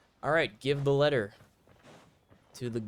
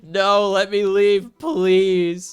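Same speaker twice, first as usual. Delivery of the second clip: slow, loud, stretched-out whine; whiny begging; 6 semitones higher, 9 dB louder, much slower than usual. Real, not acted. Begging the game to leave.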